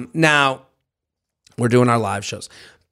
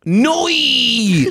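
Aussie "Noi" with a drawn diphthong; Australian accent; 7 semitones higher than usual. Shown as a demonstration. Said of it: "let me do this in my Australian accent".

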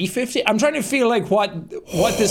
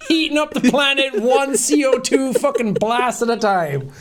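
mock triumphant taunt; 6 semitones higher, slower than usual. Mock victory taunt continues.

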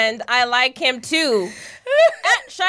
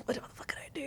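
whisper; 21 dB quieter, much faster than usual. Retold or imitated. Retelling panicked thought at party.

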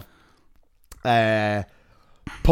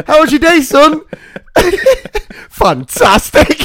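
high, loud, through laughter; laughing mock dialogue; 11 semitones higher, 6 dB louder than usual. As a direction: play parent and son while laughing. Parent and son voiced.

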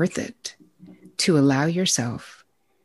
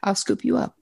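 put-on boyfriend's cheerful line; 9 semitones higher than usual. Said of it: "he used to always say".

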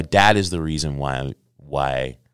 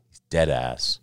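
ordinary delivery

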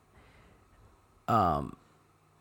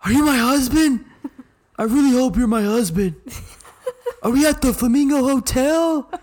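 high, loud, hammed-up drunk girl; mock drunk voice; 12 semitones higher, 5 dB louder than usual. Imitating drunk Bethany to her, high and loud. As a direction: squeal it like a drunk friend lost in a hotel. Mocking drunk friend's questions.

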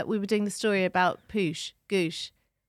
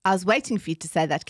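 usual speaking voice

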